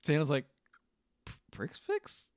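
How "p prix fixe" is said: "Prix Fix" as "pricks ficks", slow and rising in disbelief; mispronounced, questioning. Retold or imitated. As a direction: softly query "Prix Fix" with both x's sounded as ks. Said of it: "he says it completely wrong".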